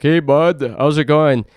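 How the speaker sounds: put-on a light Canadian accent, friendly; a hint of Canadian "hey bud". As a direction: toss it off in a mock Canadian accent. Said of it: "people say Canadians sound like".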